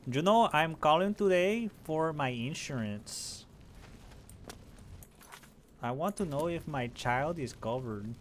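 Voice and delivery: put-on high, slow, heavy Spanish accent; a slow high caller with a thick Spanish accent. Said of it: "They have a thick-ass accent".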